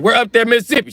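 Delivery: hammed-up high-voiced query; mock questioning. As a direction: pitch it way up, exaggerating "up there, Mississippi". Acting out question to woman.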